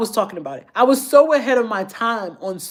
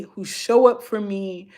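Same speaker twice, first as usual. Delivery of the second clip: ordinary delivery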